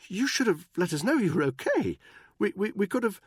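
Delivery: high, loud, nervous stammer; stammering Buckingham. A narrator's reading. Buckingham cornered by Danny, stammering high. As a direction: trip over the words in a high upper-class voice.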